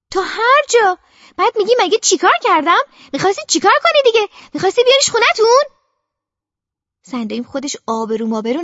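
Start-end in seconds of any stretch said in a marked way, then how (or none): 0.1-5.8 high, loud, fast accusing questions; furious aunt. A narrator's reading. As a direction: hammer her with angry questions.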